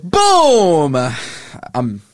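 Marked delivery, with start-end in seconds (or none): 0.0-1.0 sudden loud shout of boom; a startling on-air boom. Real, not acted. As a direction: blast the word out. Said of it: "sorry, that was pretty loud".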